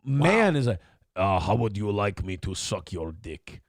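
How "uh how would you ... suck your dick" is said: lower, softer mock Russian man's accent. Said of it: "That's a guy".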